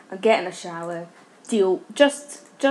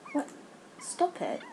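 ordinary delivery

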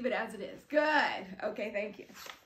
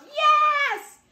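much higher, louder cheer; class yells yes; 8 semitones higher, 7 dB louder than usual. Acted. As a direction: shout yes as a whole class. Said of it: "And everybody yelled".

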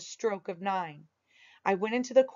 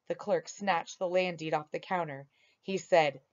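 usual speaking voice

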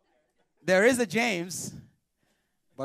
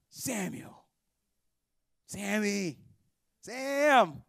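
put-on calling the boy; much slower than usual. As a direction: call out, getting more insistent each time. God calling the boy at night.

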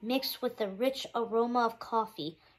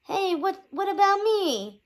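put-on much higher, louder kid's protest; higher pitched voice; 8 semitones higher, 5 dB louder than usual. A narrator's reading. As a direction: squeak out a left-out protest. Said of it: "this one higher pitched".

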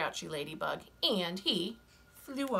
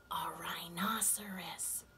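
hushed storyteller whisper; 7 dB quieter, slower than usual. A narrator's reading. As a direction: breathe the name for suspense. Suspense before new animal.

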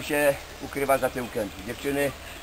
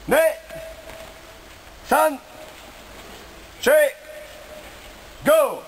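shouted dojo commands; shouted drill count; 11 semitones higher, slower than usual. Real, not acted. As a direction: call the count like a sensei. Count for the kiai.

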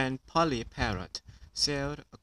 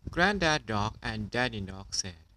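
plain reading voice

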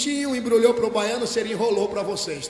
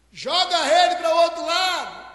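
acted far-carrying holler; calling out; 6 semitones higher, 3 dB louder than usual. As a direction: shout an order to a distant boat. Calling out to fishermen at sea.

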